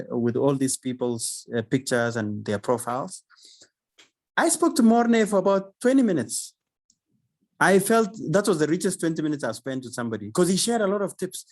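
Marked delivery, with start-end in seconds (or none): 4.4-9.4 excited. Real, not acted. Thrilled about speaker call.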